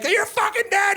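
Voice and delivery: high-pitched enraged threat, acted out; livid. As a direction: play the livid brother shouting. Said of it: "He's, like, livid".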